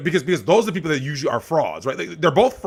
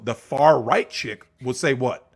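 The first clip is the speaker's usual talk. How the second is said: ordinary delivery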